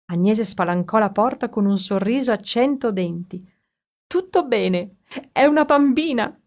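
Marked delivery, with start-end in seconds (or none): 4.1-6.3 higher, louder happy news; joyful announcement. Acted. Midwife announces the baby girl to the father, joyful and loud. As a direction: burst out with the good news.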